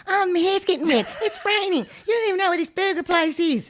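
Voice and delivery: mock her moaning in a squeaky high voice; mocking ex-wife. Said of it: "Then I heard".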